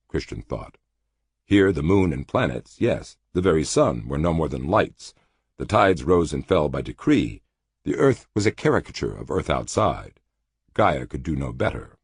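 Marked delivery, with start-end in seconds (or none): none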